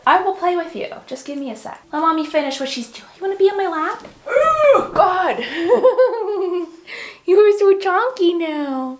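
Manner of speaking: high, sing-song cooing at the puppy. Real, not acted. Cooing at her dog Peaches.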